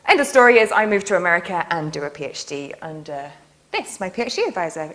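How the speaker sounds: ordinary delivery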